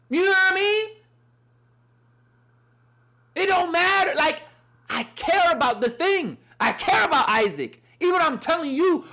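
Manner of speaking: high, loud preacher's cry; impassioned sermon climax; full-force preaching. Acted. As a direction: preach it out at the top of your voice. Preaching with rising passion.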